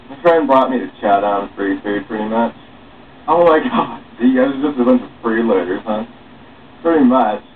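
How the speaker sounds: usual speaking voice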